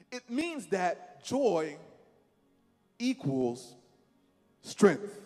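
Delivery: each word set apart with long pauses; slow and emphatic. Shown as a demonstration. Preacher to congregation, slow pausing emphasis. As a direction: lay it down slowly, word by word. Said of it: "I'll say it again".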